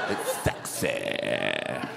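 put-on low, slow, lazy teen drawl. Echoing the boy, slowed.

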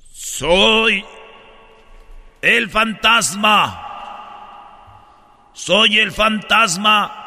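slow, drawn-out ghost voice; spooky slow ghost announcement. Acted. Ghost speaks in joke.

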